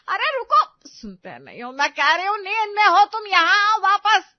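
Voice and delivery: high, loud calling out; shouting after him. Acted. Wife shouting after her sleepwalking husband, loud and high. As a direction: yell at him to come back.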